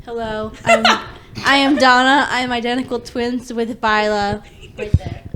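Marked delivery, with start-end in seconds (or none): none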